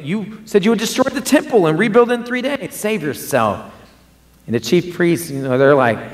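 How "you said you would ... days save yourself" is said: mocking taunt. Mockers taunting Jesus on cross.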